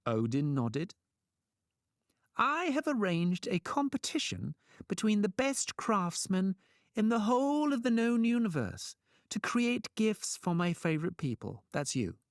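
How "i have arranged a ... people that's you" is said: high showman's announcement, easing down at the end; grand trickster pitch. A narrator's reading. Trickster sells his competition to gods, grand.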